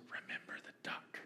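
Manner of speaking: sly secret whisper. A narrator's reading. Sister blackmailing her brother, sly whisper. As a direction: breathe it as a threat only he hears. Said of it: "she leans over and whispers".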